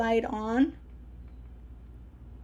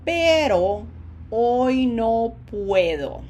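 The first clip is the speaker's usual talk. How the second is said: slow, louder Spanish with stretched vowels; slow model sentence; 5 dB louder, slower than usual. Shown as a demonstration. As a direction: draw out each word for learners to copy. Teacher models a sentence for repetition.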